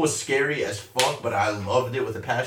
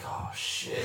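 mock whisper; 11 dB quieter than usual. Scared approaching coaster, quoting self.

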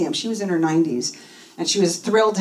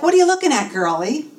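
high-pitched nosy passerby, dropping on the last word; mock challenge, pitched way up; jogger's challenge; 8 semitones higher than usual. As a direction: call out a high, pointed question as a stranger. Jogger accosts her in story.

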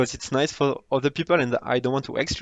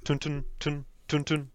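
choppy tun sounds; 8 dB quieter than usual. Shown as a demonstration. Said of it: "It's not like a melody".

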